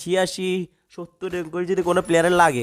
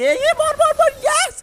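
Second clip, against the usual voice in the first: very high, loud "kill, kill"; shrill cheering; 6 dB louder than usual. Real, not acted. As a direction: egg yourself on to the kill. Urging a kill. (খুব উঁচু গলায় "মার মার" বলে উল্লাস)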